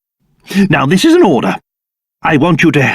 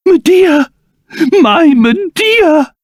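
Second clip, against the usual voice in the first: joyful cry; 7 semitones higher, slower than usual. Acted. Reunited with daughter.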